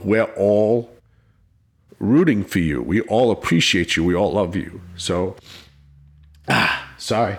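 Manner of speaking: real emotion, low and halting; choked up. Real, not acted. Officer recalling a third grader's card, choked up. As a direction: struggle to hold it together, voice sinking. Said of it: "now I'm getting choked up".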